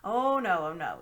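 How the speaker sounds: loud, shocked protest; shocked old uncle. Acted. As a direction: recoil at the very idea. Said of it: "in a shocked voice".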